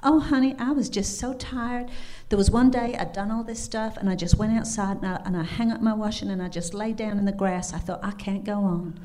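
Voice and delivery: amateur Kentucky twang for a weary woman; bad Kentucky accent. Cabin owner recalls being worn out to her guest, Kentucky accent. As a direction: retell it as a tired Kentucky woman. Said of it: "my very bad Kentucky accent".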